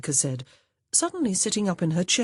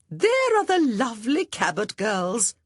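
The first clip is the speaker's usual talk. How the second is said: high, sing-song society lady; trilling; 7 semitones higher, 4 dB louder than usual. Acted. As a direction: trill it sweetly like a gushing matron. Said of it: "a voice familiar to them both trilled".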